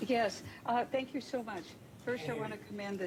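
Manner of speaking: faint, far from the mic; barely audible, very soft; too quiet. Real, not acted. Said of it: "speak up, please?".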